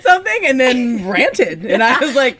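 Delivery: high, laughing through the words. Real, not acted. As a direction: giggle while teasing. Laughing at friend's rant.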